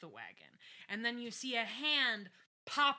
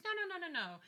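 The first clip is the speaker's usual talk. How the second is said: dismissive quote; 10 semitones higher, faster than usual. Retold or imitated. Acts Roger brushing off worry.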